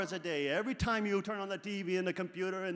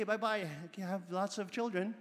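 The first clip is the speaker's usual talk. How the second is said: ordinary talk, nothing marked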